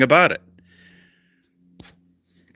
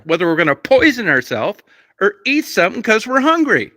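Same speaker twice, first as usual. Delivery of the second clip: much higher and louder, furiously ranting; angry yelling; 11 semitones higher, 4 dB louder than usual. Retold or imitated. Reliving a fight with his wife over lottery tickets, yelling angrily. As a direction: shout him down in a heated argument. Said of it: "you don't need to talk to me in that tone of voice".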